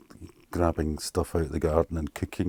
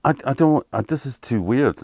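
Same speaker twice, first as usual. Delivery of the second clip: agitated; 8 semitones higher, 6 dB louder than usual. Real, not acted. Recalling distress, voice up.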